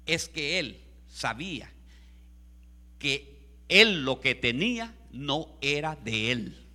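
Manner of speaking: ordinary delivery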